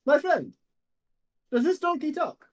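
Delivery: high, astonished hero voice; big theatrical question; Demos. Acted. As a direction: marvel in a grand, high-pitched voice. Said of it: "Demos turns and goes".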